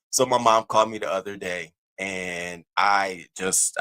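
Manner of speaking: ordinary delivery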